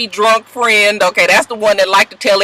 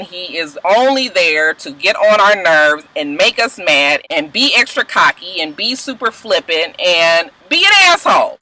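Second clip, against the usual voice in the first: normal talking voice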